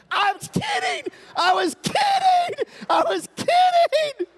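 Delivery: very high, drawn-out mock screams; panicked screaming. Son pleading as dad charges, hammed-up panicked scream.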